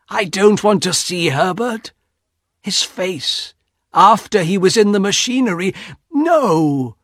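high-pitched, mounting dread; horrified thought. A narrator's reading. Husband imagines son's face after machinery, horrified. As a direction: recoil from the picture, ending in refusal.

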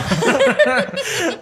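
a loud, high laugh that falls away. Real, not acted. Cracking up over the taxi driver story, loud high laugh.

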